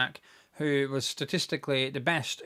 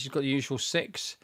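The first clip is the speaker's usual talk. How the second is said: ordinary talk, nothing marked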